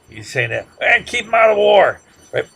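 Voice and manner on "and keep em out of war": high, loud mock Texas drawl; loud imitation of a Texas politician's promise; Johnson's Texas drawl. Lecturer still mocking Johnson's campaign pledge in a Texas drawl. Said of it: "in his Texas drawl".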